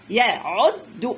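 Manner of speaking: يا, then عد stopped on د; teacher's slow split of يا عد; syllable by syllable. Shown as a demonstration. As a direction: sound out يا عد slowly in separate pieces. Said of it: "خد واحدة من دي طالما وراها شدة".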